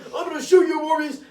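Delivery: high, loud cop bark; mock cop shout. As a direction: threaten him like a cop. Cops threatening Morbius.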